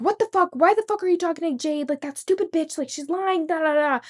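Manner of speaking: high, fast mock angry rant; cussing out, imitated. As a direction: imitate an outraged boyfriend's tirade, pitched well up. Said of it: "He's, like, cussing me out".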